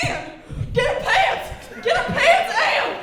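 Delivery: high, loud frantic shout to the wife; hammed-up alarmed father-in-law shouting; acted panicked yell. Father-in-law yells in alarm for help.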